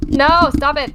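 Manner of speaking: loud protest. Real, not acted. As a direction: push back loudly at the teasing. Protesting the ASMR teasing.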